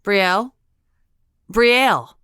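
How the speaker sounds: rising from an urgent call to a loud high shout. Acted. Friend calling the knocked-out girl, rising to a shout.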